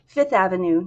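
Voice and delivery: ordinary delivery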